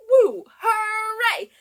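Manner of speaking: high, loud, laughing woo hooray; excited cheer. Acted. Teacher cheering with the kids in the song. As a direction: let the cheer burst out. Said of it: "do all three".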